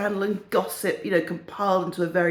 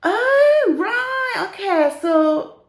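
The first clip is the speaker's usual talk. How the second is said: put-on high, loud northern old lady; hammed-up northern English reaction, drawn out; northern English accent; 13 semitones higher, 5 dB louder, much slower than usual. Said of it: "have a go at the accents".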